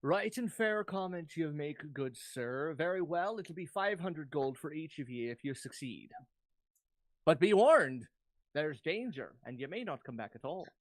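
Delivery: slow Irish mayor, grave warning at the end; measured Irish-accented town official. Acted. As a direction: grant the reward in an Irish lilt. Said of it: "Now, we'll roleplay this".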